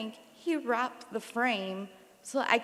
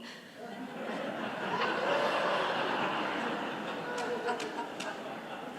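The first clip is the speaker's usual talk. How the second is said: long airy laugh, much higher; 13 semitones higher than usual. Real, not acted. Remembering dad's ceiling joke, she laughs long and high. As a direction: cracks up helplessly.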